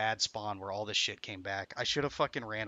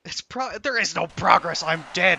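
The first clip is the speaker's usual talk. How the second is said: real loud outburst at losing everything; frustrated shout; 7 semitones higher, 11 dB louder, slower than usual. Real, not acted. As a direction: yell it, gutted and furious. Hardcore character just died.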